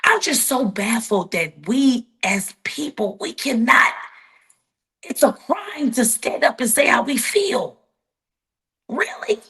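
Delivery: holding back tears as the words pour out; fighting back tears. Real, not acted. Telling the interviewer how baffled she is, near tears and shouting. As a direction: voice climbing high, upset and nearly crying, rising to a shout. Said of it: "and I'm trying not to cry".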